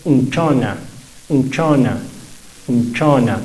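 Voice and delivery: un-CHO-na, the u light; Chibcha pronunciation. Shown as a demonstration. Teacher drills unchona for students, stress on the o. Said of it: "Nunca va a tener el acento principal".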